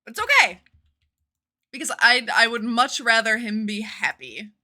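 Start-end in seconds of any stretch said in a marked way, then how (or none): 0.0-0.6 high, loud strained "okay"; forced cheerful. Pretend. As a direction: push out a brave okay. Forced brave acceptance.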